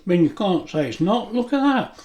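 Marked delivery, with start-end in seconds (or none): 1.3-2.0 excited. Real, not acted. Admiring his cooked dinner.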